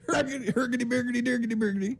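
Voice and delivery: high, soft babble of nonsense syllables; mock ranting gibberish. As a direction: mimic an online ranter in high gibberish. Gibberish mocking of online ranters.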